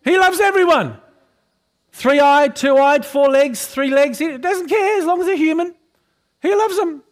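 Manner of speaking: high, loud, fervent preaching; full-force preaching. Acted. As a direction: preach it out with rising fire.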